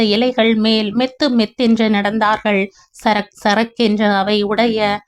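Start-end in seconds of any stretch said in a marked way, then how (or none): none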